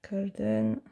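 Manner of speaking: "Golden" repeated softly to herself in French-accented English. Real, not acted. Crafter repeating the word for herself, soft French-accented English. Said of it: "mon accent est déplorable".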